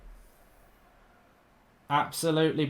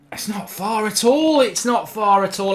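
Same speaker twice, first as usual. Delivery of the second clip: exasperated; 5 semitones higher, 6 dB louder than usual. Real, not acted. Shocked at a near miss.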